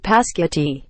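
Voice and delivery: PASQUITI said for spaghetti, p first; childlike spaghetti. Shown as a demonstration. Admitting an own mispronunciation, garbled PASQUITI. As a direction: swap the sounds into PASQUITI. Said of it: "yes, I pronounce it pasquiti".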